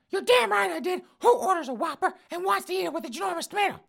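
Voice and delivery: put-on high, loud huffy old lady; old woman voice. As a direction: squawk it out like an outraged old lady.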